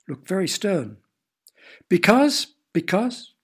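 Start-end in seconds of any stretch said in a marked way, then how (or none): 1.9-3.3 a loud, much higher "Because" that loses force; blustering start. A narrator's reading. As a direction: bluster as if a reason will come. Father flounders for a reason.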